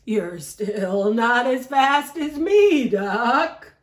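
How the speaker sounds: boastful horse. Acted. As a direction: brag like a smug horse showing teeth. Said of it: "we have to use the voices".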